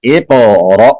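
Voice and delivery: "اقرأ" with echoing q, ending on a jolt; qalqalah and jolt. Shown as a demonstration. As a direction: bounce the q and chop off "اقرأ" at the hamza. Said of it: "را کو جھٹکا لگا کے پڑھیں گے".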